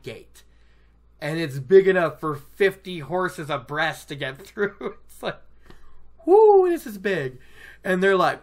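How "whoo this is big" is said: loud, high-pitched wonder; hammed-up loud amazement; amazed whoop. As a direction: whoop at the sheer size. Acting out awe at gate.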